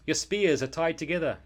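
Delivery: loud, high gleeful jeer; boy taunting the guards from the windowsill; taunting shout. Acted. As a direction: crow it at the guards. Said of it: "shouted Eric".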